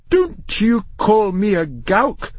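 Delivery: high, slow, affronted comeback; indignant retort. A narrator's reading. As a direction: take offence and stretch the words. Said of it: "the voice retorted".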